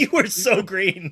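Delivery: high, loud laughing tease. Real, not acted. Friend teasing cohost about green shirt, laughing. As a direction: crack up while teasing him. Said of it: "laughs at green screen".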